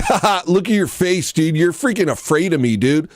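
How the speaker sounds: sneering mock taunt; taunting bro. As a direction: gloat like an obnoxious viewer. Mocking the troll chatter.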